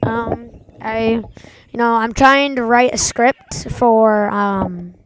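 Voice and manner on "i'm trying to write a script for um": ordinary talk, nothing marked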